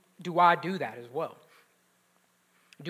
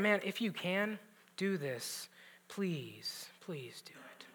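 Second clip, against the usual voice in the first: softer and softer, ending in a whisper; quiet plea; 12 dB quieter, slower than usual. Retold or imitated. Tired father pleading with Jesus, fading to a whisper. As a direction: beg quietly, losing hope.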